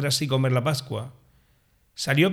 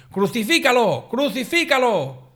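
high, loud mob cry, the second slower; crowd shouting; 7 semitones higher, 3 dB louder than usual. A narrator's reading. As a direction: shout it like an enraged crowd demanding death. (grito alto de la multitud, el segundo más lento)

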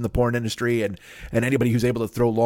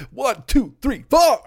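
higher and louder, a shouted count-in; punk count-in; 13 semitones higher, 6 dB louder, slower than usual. Pretend. Podcaster counts in his imaginary punk band, shouting. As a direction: yell the count like a punk drummer.

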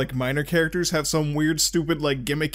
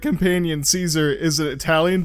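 ordinary talk, nothing marked

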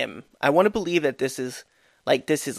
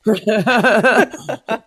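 big loud burst of laughter; 8 semitones higher, 8 dB louder than usual. Real, not acted. Laughs after the commercial.

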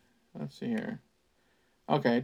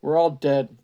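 called out, louder; calling back; 7 dB louder than usual. Acted. Paul calling back to Albert outside, louder. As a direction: call it back across the camp. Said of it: "Paul called back".

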